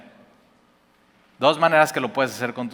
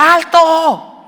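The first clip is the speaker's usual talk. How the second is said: much higher, much louder yell of stop; shout; 9 dB louder than usual. Retold or imitated. Father yelling at his son driving into the intersection, full shout. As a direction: yell it to stop the car dead. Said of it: "le tengo que gritar".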